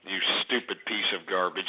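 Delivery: low, soft, disgusted insult; seething contempt. Real, not acted. Host insulting a caller who takes government checks, seething. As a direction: keep it low and full of disgust.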